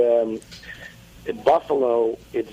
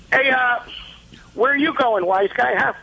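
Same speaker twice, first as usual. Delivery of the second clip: put-on bust his chops like an old-school father; impression of Mister Fitzgibbons; 8 semitones higher, faster than usual. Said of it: "I do Mister Fitzgibbons".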